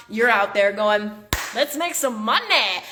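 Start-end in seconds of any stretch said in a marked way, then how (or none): 1.5-2.8 slow, confident, eager; upbeat go-getter. Retold or imitated. Coach shows listeners the winning attitude: slow, eager confidence. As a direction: sound ready to cash in. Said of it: "this is the energy to come into it with".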